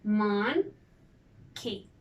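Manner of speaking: two well-separated beats on "Monkey"; split into syllables. Shown as a demonstration. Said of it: "Two syllables".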